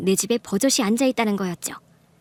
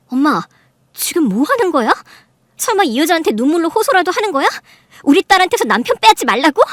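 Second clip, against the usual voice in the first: loud, raised-pitch incredulous questions; indignant confrontation; 6 semitones higher, 7 dB louder than usual. Acted. Daughter pulling her mother off the mistress, indignant. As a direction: fire accusing questions, getting louder.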